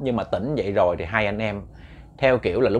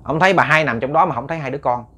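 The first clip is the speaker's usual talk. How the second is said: ordinary talk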